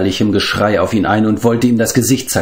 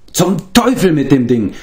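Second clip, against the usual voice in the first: higher-pitched curse, not shouted; exasperated exclamation; 6 semitones higher than usual. A narrator's reading. Flavdur curses his harp, exasperated. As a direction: burst out in annoyance. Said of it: "rief er aus".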